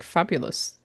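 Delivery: ordinary talk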